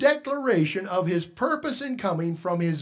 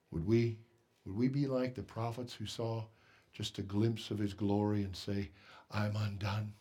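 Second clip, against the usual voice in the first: soft, much quieter, drawn-in preaching; hushed musing; 11 semitones lower, 9 dB quieter than usual. Acted. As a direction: wonder aloud gently to the congregation. Quiet reflective passage in sermon.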